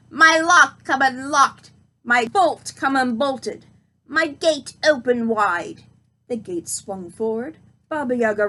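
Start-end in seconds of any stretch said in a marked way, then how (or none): 0.1-5.8 slow, loud commanding witch cry; witch shouting. Acted. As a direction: bellow the spell at the gate. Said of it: "Then she shouted again".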